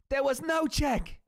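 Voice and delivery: put-on high, slow Irish accent; Irish Conor quote. Streamer reciting Conor's line, Irish accent. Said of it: "I know all the quotes".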